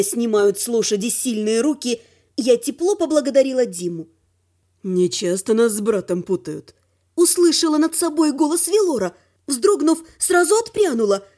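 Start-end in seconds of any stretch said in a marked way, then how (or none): 4.8-6.7 low male voice; understated, lower-voiced Velor; dry dragon. A narrator's reading. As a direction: drop low, coolly amused.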